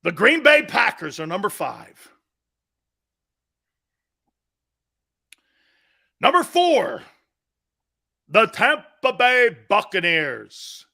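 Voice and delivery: countdown announcer. Acted. Host revealing the next team on his list, announcer style. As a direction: announce it like a big draft pick.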